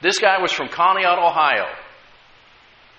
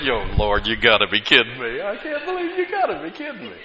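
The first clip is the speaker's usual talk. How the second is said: mock disbelief; 3 semitones lower than usual. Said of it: "And I'm like".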